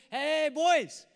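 loud, high drawn-out hail; calling out. Acted. Jesus calls again from the shore, calling out. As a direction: holler to a distant boat.

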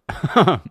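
laughing at himself; burst of laughter. Real, not acted. Laughs at own story.

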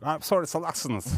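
slow, accented mock apology with a laugh; Kerem Bürsin. Host mimics the actor calling people fools, laughing accented imitation. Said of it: "Kerem Bürsin gibi oldun".

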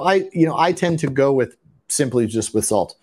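ordinary talk

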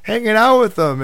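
high, loud excited retelling; insistent girls imitated.

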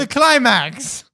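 slow, high, loud punchline; mock dramatic climax. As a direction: drag out the punchline. Joke punchline about seismograph sex.

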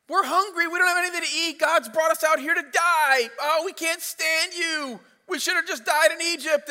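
high, loud hammed-up whine; whiny complaining crowd. Preacher voicing hungry Israelites grumbling at Moses, high mock whine. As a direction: complain like spoiled kids. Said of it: "they're like".

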